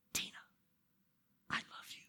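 tender whisper to one person; barely audible, intimate whisper. Shown as a demonstration. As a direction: whisper it as a secret. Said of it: "So that's why I'm whispering".

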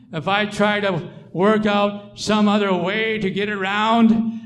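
loud, driving sermon voice; full-force pulpit delivery; fervent preaching. Acted. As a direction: preach it hard to the congregation.

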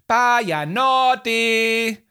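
high, long drawn-out holler; stretched-out high-pitched yell; loud shout. A narrator's reading. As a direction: shout it desperately while dashing off. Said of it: "råbte hun højt".

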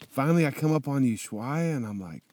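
ordinary talk

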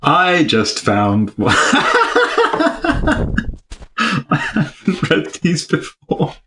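breaking into high laughter while reading; can't stop laughing. Real, not acted. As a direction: lose it laughing mid-sentence. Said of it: "can't say Weetabix without laughing".

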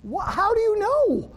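hammed-up surprise; mock shock. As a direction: act caught red-handed. Caught out, acted surprise.

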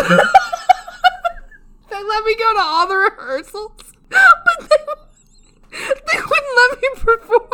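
high, breaking voice through helpless laughter; laughing hard. Real, not acted. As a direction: squeeze the words out while cracking up. Laughing at own bad performance.

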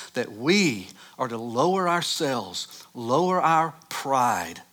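slow, weighty sermon emphasis; measured pulpit delivery; preaching. Acted. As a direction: lean on each phrase like a pastor.

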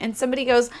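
ordinary delivery

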